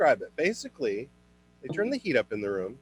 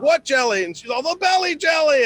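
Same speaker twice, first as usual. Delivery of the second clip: high, louder hammed-up alarm; mock shrill panic; 13 semitones higher, 7 dB louder than usual. Mom learning the jelly was forgotten, mock alarm. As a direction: squeal it in mock horror.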